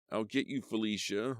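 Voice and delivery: hurt man calling a threat; menacing call. Acted. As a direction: call after her, a bit stronger. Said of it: "Lloyd called".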